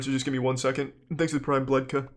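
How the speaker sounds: ordinary delivery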